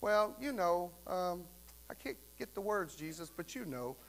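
soft, fading, fumbling for words; stammering prayer. Retold or imitated. A believer stammering an honest prayer to Jesus, softly. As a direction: trail off searching for words. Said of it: "it's okay to say".

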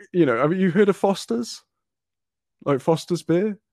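ordinary talk

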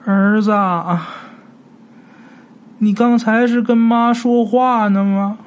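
high, slow, drawn-out crooning voice; eerie ghost mother. Acted. Ghost old woman crooning to the son she thinks she found.

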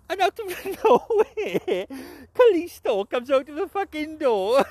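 high, slow, laughing disbelief. Real, not acted. Recapping the Kalisto surprise to viewers, laughing slow disbelief. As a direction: laugh it out in amazement, drawn out.

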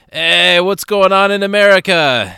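put-on loud, high drunken holler; tipsy street musician. Drunk guitarist hailing missionaries, loud tipsy holler. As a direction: call out like a merry drunk. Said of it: "he'd been drinking a little bit".

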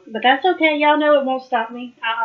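normal talking voice

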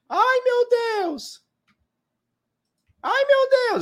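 very high, slow falsetto whine; mock panic. Mocking the small investor panicking over the price, in a high whine. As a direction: whimper like a scared little investor.

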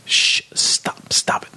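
hushed whisper, no voice; whispered shush. Retold or imitated. Son shushing his dad during Game of Thrones, whisper. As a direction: whisper it so nobody hears. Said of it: "I'm like".

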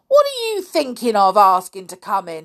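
loud, indignant outburst; crying out. A narrator's reading. Caretaker outraged that boys ask to come in, crying out. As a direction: cry out in indignation. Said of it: "he cried".

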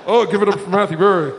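a barely changed mock announcer voice; hint of a put-on hype-man voice; different voice. Host trying a disguised voice to praise himself, barely altered announcer. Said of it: "Still not working".

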